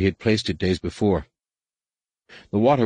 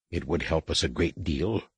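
softer, grudging growl; reluctant growl; 2 semitones lower, 5 dB quieter than usual. A narrator's reading. Rapung concedes to Anakin, a mild reluctant growl. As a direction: growl the admission softly and reluctantly. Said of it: "Rapung growled reluctantly".

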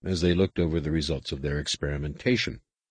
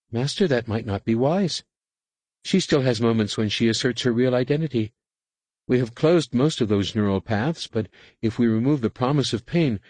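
higher, pleading underling voice; a servile adept, higher and worried; anxious adept; 5 semitones higher, 3 dB louder than usual. A narrator's reading. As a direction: nervously object to the master. Nenyim pleads with Master.

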